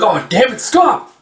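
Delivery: acted distress, pitch shooting up; desperate protest. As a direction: cry climbing very high. Man being beaten begs stop.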